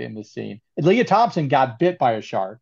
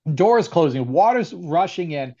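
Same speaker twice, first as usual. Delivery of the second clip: usual speaking voice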